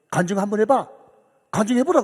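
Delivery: sudden loud pastoral order; retold brusque command. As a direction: bark the demand like a senior pastor. Pastor abruptly demands a testimony.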